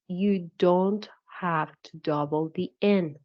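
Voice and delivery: ordinary talk, nothing marked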